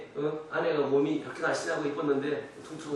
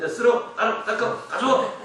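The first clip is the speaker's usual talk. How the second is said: mock barked orders, pitched up; bossy father; 6 semitones higher, 6 dB louder than usual. Father ordering children to clean, mock barking. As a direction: imitate a dad barking military-style commands. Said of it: "우리 아빠들이 막 이러죠".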